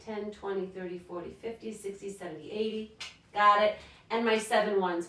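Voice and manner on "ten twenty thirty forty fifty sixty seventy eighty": much softer, barely voiced counting; murmured counting while working; counting under breath. Real, not acted. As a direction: count quietly to yourself. Counting by tens to herself.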